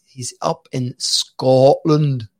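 usual speaking voice

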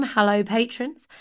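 normal talking voice

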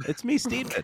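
squeaky high silly sidekick; goofy high character voice. Pretend. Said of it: "Can we go with Steedman?".

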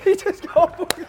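losing it laughing mid-story; high-pitched, laughing hard; real helpless laughter. Real, not acted. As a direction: squeal it out, high and loud, through laughter. Cracking up at own story.

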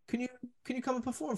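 high, fast mocking voice of the booker. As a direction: rattle it off high and quick as the pushy booker. Retelling the Vegas booking request.

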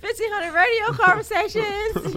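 high, loud, laughing plug; goofy shout-out. Retold or imitated. Caller plugging the podcast on the radio, goofy and loud. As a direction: belt it out awkwardly and giddily.

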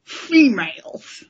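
slow, drawn-out Ferengi sneer on Females. Retold or imitated. Host to co-hosts, imitating a Ferengi saying the word. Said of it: "spoken through those Ferengi teeth".

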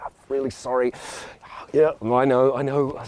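lightly voiced over-apologetic Brit; a hint of a fussing, sorry Brit. British traveller excusing himself to a family, mildly hammed-up apology. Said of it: "If I was British, I'd be like".

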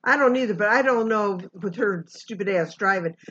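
ordinary talk

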